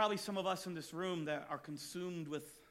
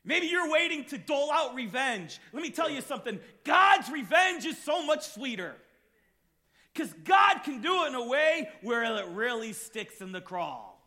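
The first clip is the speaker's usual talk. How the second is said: loud, high, insistent sermon; a preacher shouting about God's revenge; full-force preaching; 7 semitones higher, 10 dB louder than usual. Real, not acted. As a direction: press the point hard on the congregation.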